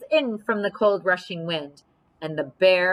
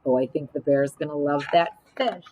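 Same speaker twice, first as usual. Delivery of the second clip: ordinary delivery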